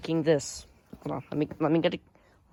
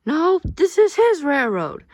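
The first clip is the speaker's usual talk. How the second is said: much higher, louder, slow insistence on "his"; hammed-up squabble over "his" railroad; mock quarrel; 10 semitones higher, 7 dB louder, slower than usual. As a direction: argue like a stubborn kid.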